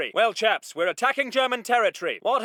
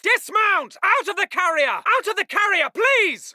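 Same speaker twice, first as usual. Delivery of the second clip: officer calling out a dismount; urgent shouted order. Acted. Officer ordering chaps out of the carrier, urgent shout. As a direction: command them out with voice raised.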